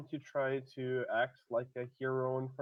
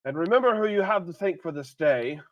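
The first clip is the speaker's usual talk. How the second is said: loud, raised call to the crowd; heroic proclamation; 6 semitones higher, 9 dB louder than usual. Acted. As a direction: proclaim it like a hero. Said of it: "I'm gonna say out loud to everyone".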